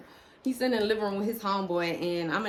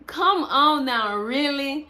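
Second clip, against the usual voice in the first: high, drawn-out incredulous protest breaking into laughter; mock disbelief; 6 semitones higher, 4 dB louder, slower than usual. Real, not acted. Woman ribbing fiance's reaction, laughing disbelief. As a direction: draw it out, loud and disbelieving.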